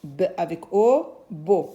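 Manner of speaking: teacher spelling aloud slowly; slow spelled out. Shown as a demonstration. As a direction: sound it out for small children. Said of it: "on va écrire la syllabe beau".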